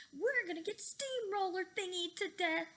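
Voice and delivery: high, loud scared scream. A narrator's reading. Fluffy facing the steamroller, high loud scream. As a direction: scream in terror. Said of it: "screamed Fluffy".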